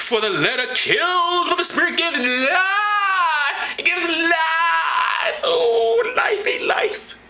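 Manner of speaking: high, slow wailing cry shouted; mock sobbing preacher. Drinker hams up a tearful sermon on the spirit, slow wail. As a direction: overwrought fake weeping sermon.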